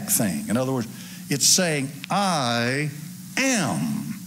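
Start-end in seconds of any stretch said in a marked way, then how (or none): 2.1-4.2 passionate, authoritative "I am"; emphatic I am. Shown as a demonstration. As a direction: hit "I am" with power and authority. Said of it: "with emphasis, with passion, with power and authority".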